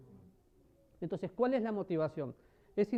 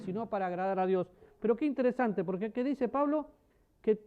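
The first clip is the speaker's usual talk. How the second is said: ordinary talk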